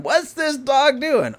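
high, slow, baffled squeak; puzzled guest. Retold or imitated. Houseguest asking about the dog, high baffled voice.

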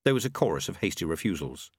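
plain reading voice

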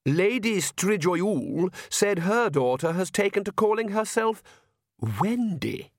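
high, slow society lady; gossiping countess mother. Acted. Countess gossips about a neighbour's daughter, primly appalled. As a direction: report the scandal with prim disbelief.

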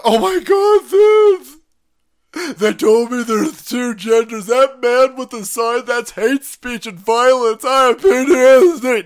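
high, loud shrieking mock voice; mocking leftist. Mocking leftists crying PTSD over a sign, loud high shriek. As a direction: shriek it like a hysterical caricature. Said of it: "assholes ... who are like".

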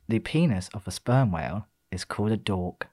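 low, soft fact delivery; calming it down. Real, not acted. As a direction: deliver a random fact quietly, deadpan. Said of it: "just to calm it down".

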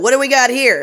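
loud stock detective line; cop cliché line. Retold or imitated. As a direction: play the arriving detective. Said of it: "he's like".

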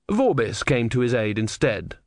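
ordinary delivery

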